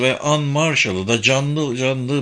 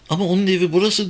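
higher, rushed exclamation without much volume; mild cry of protest; 8 semitones higher, much faster than usual. A narrator's reading. Simone protests to Kenneth over the photographs, mildly crying out. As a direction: blurt it out high and fast, a restrained shout. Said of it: "diye bağırdı Simone".

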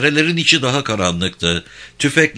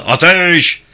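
sharp loud order to fire; shouted command; 8 semitones higher, 7 dB louder than usual. Acted. Teğmen Galip fısıldadı.

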